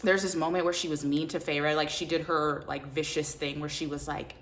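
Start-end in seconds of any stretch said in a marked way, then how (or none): none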